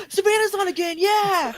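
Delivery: high, loud thrilled cheer; excited fan. Retold or imitated. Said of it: "some guy that's like".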